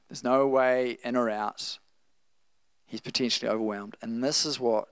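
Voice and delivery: usual speaking voice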